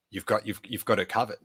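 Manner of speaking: soft, understated aside; hushed little sign of approval, retold; discreet acknowledgment. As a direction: imitate a quiet knowing nod in words. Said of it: "Carrie sort of nodded to me".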